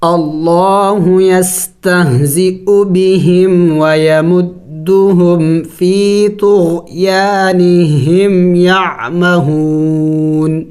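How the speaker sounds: slow, drawn-out tajweed recitation; Quran recitation. Shown as a demonstration. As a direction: recite the verse slowly with melody. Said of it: "ملا کے پڑھتے ہیں". (آہستہ ترتیل سے تلاوت)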